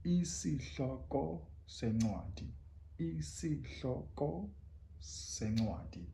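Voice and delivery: Isihloko sencwadi slow and soft; isiXhosa phrase. Shown as a demonstration. Teacher modelling the full phrase slowly. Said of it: "in one piece".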